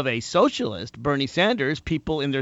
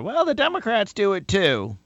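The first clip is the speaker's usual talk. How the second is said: imitating a naysayer, pitched higher; mock naysayer; 6 semitones higher than usual. Host mocking the critic who says both sides do it. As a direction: sneer it like a dismissive critic. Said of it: "you hear".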